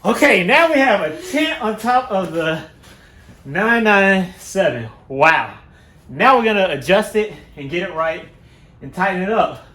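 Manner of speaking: loud, pleased announcement to camera; excited. Real, not acted. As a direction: proudly show off the finished tent. Excited after tent placed.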